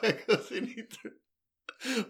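high grand mock proclamation; quoting Dale. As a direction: ham up the solemn line. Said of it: "echoing Dale's line".

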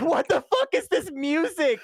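high-pitched, incredulous outburst; laughing disbelief. Real, not acted. Host reacting to awful film music, incredulous. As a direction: exclaim it through exasperated laughter. Ranting about the ridiculous soundtrack.

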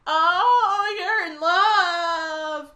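high, slow singsong tease; teasing singsong. Retold or imitated. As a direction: sing it out like teasing kids. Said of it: "the kids are like".

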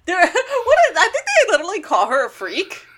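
high, laughing through words. Real, not acted. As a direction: laugh while telling it. Laughing while talking.

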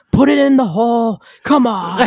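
loud, high-pitched heckling yell. Retold or imitated. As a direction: yell at the players like a rowdy fan. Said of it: "I'm just yelling".

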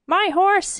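high-pitched call for the stables; urgent cry. A narrator's reading. King stampeding toward the stables, crying out. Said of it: "he cried".

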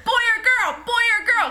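high, loud repeated demand; retold yelled question; pissed-off shouting. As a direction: fire it out like an angry heckle. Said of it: "I was, like, getting hella pissed".

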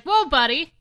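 ordinary delivery

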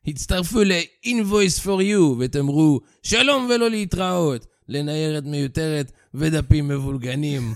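Hebrew ad read in a French accent. Shown as a demonstration. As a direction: play a Frenchman reading a Hebrew ad. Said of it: "נא לקרוא במבטא צרפתי".

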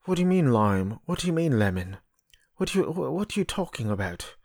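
lightly put-on British accent; a hint of mock British. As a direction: imitate an actor's attempt at British. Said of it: "on a British accent too".

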